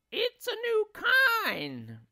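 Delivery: very high, slow sing-song wife; sly wife. Acted. Wife passes off worms as spaghetti, high coaxing. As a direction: coax him in a high drawn-out voice.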